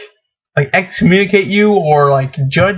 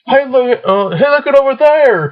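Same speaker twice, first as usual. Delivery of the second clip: mock calling out; 6 semitones higher than usual. Acting out pointing at someone.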